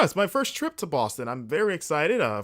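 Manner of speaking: usual speaking voice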